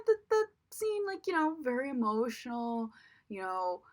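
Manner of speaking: ordinary talk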